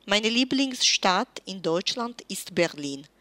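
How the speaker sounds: ordinary talk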